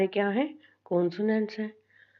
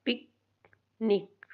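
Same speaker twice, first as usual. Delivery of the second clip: Picnic as short पिक, long pause, short निक; two short beats in picnic, far apart; split into syllables; much slower than usual. Shown as a demonstration. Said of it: "ये syllables निकाल रहे हैं".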